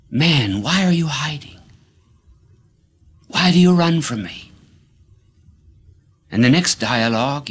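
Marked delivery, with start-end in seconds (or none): 0.1-4.5 lightly voiced God, higher-pitched pleading questions; God calling man. God questions hiding man in the garden, lightly acted, raised voice. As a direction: put God's questions to man, raised and insistent. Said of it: "God saying to man".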